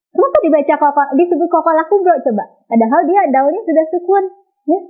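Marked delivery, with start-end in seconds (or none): none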